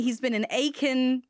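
a put-on Southern accent. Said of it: "never do that Southern accent again".

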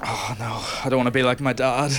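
ordinary talk, nothing marked

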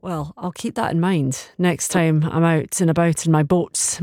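usual speaking voice

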